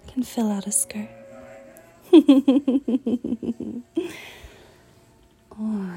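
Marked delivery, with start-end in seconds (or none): 2.1-4.5 high playful giggle; flirty giggle. Acted. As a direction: giggle teasingly. Giggle during striptease.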